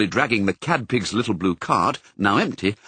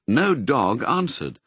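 plain reading voice

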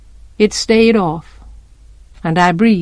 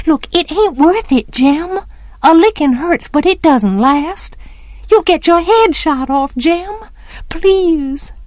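put-on high, desperate child's plea; 8 semitones higher than usual. A narrator's reading. Child begging Jem not to go, desperate. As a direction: plead desperately, pitched well up. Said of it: "I was desperate".

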